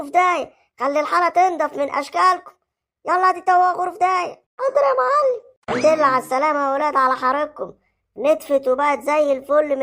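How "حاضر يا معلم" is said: whimpering submission. Acted. Beaten man submits.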